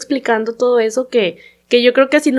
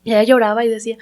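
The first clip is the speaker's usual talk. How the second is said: ordinary talk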